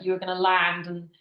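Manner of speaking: ordinary delivery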